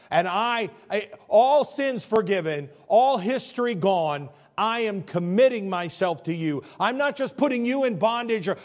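high, loud-edged sermon delivery; impassioned preaching. Acted. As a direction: preach it as a husband's fervent pledge. Preaches Hosea's vow.

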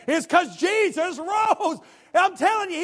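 high, loud, slow proclamation; preaching at full force. Acted. Preacher proclaims to the church, loud and high. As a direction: shout the good news.

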